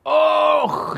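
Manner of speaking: a very high, loud cry; God crying out through Paul, high and loud; anguished cry. Acted. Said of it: "riep God door Paulus heen".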